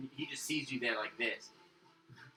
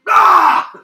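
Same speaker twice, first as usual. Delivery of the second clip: very high, very loud sudden roar; a startling monster roar; troll roar; 26 dB louder than usual. Acted. Troll grabs the player by the shoulder, sudden roar.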